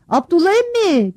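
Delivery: high, slow calling shout; children calling loudly to uncle Abdullah. A narrator's reading. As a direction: call out to uncle Abdullah from afar. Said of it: "diye bağırdı".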